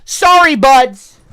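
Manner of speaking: high, loud smug taunt; gloating at viewers before logging off; mocking tease. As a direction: tease them slowly. Teasing chat, leaving.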